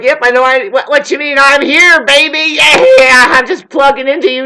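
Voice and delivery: mock a smug narcissist sweet-talking. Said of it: "a lot of narcissists are like".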